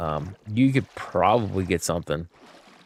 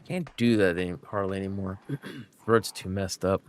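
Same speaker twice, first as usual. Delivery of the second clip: ordinary delivery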